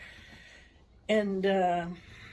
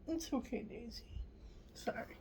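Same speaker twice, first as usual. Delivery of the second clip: soothing the dog; 3 semitones higher, 12 dB quieter than usual. Real, not acted. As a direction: hush the dog gently, pitched up. Soothing her dog Daisy.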